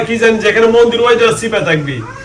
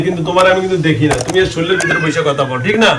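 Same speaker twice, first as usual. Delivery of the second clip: ordinary delivery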